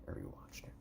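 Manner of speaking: whispered aside; hushed voice. Real, not acted. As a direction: drop to a whisper for a quick aside. Quiet aside about rewatching.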